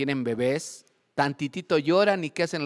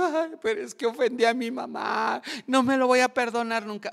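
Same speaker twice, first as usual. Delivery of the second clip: mock tearful wailing; 9 semitones higher than usual. Child who offended mom, mock wailing. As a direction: wail it like an overdramatic crybaby. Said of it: "Y se van llorando".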